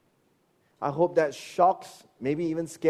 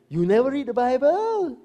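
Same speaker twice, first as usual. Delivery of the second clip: high, pointed scold; mock scolding pastor; 10 semitones higher than usual. Churchgoer imitating his pastor's rebuke, high mock scold. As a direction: wag a finger at them as a pastor. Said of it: "as my pastor scolded me".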